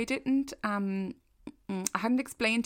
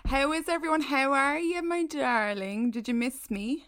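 diva greeting; 7 semitones higher than usual. Pretend. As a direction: sweep back in like a diva. Diva return to the show.

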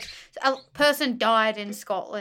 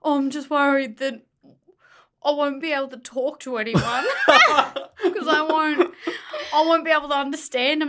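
higher-pitched mock worry; imitated fretting; 5 semitones higher, 4 dB louder than usual. Boyfriend worried about understanding people in Edinburgh, mock fretful. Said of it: "he was fretting".

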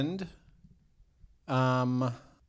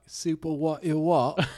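put-on raised, incredulous British accent; baffled Brit, put-on British accent; mock British accent, pitched up; Jason Statham; 5 semitones higher, 5 dB louder, slower than usual. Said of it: "had to do the accent".

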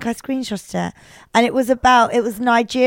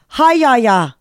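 loud Northern Irish accent hack; 2 semitones higher, 7 dB louder, slower than usual. Shown as a demonstration. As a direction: call out a mock Northern Irish greeting. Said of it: "How are you in Northern Irish".

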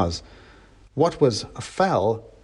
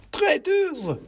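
very high squeaky accusation; another mob mouse. A narrator's reading. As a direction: squeal it as another angry little rebel. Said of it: "came another".

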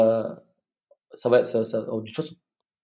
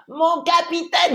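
put-on high, slow child's voice; kid soldier; 12 semitones higher, slower than usual. Boy checkpoint chief addressing the captain, high slow childish voice. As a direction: imitate the young boy saluting the captain, pitched up high. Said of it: "ça m'a fait marrer".

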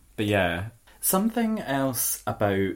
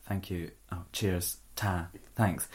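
murmured thanks; 4 semitones lower, 7 dB quieter, slower than usual. Retold or imitated. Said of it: "replaced only by".

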